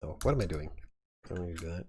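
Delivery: ordinary delivery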